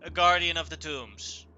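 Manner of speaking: projected character shout; shouting back. Acted. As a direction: keep hollering the reply. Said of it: "He shouts back".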